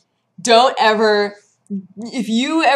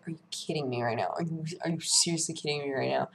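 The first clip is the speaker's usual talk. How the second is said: much lower, softer, fast exasperated muttering; mock disbelief; 5 semitones lower, 13 dB quieter, faster than usual. Retelling her fury at the opponent, low fast muttering. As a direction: grumble it under your breath.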